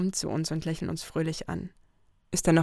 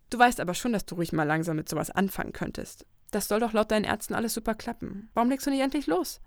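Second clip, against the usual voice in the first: higher, coaxing, rising to a nudge; pushy Lucy; 4 semitones higher than usual. Acted. Lucy urges her friend to finally start dating, high and coaxing. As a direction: egg your friend on.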